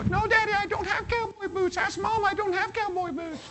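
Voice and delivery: put-on very high child's protest; squeaky little girl arguing. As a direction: play the small girl insisting to her daddy. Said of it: "girl insisting again".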